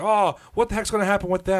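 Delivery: high, whiny mock complaint; a high, whiny impression of an indignant Leafs fan; mock outraged fan. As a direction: play up an indignant rival fan. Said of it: "He was like".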